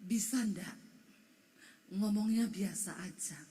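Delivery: lightly voiced low-key husband; husband's voice. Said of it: "Dia bilang gini".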